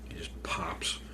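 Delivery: breathy whispered punchline. Real, not acted. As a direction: whisper it like a secret.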